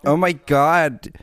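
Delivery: put-on slow drawn-out German-Russian accent. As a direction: drawl it like Julia Fox with a German-Russian accent. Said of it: "man stelle sich Julia Fox mit einem, äh, deutsch-russischen Akzent vor".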